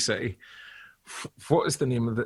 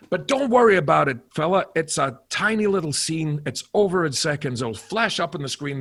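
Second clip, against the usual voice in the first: higher, louder director brushing off worries; upbeat, pitched-up director impression; John Landis; 5 semitones higher, 5 dB louder than usual. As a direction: reassure briskly, imitating the director. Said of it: "he said".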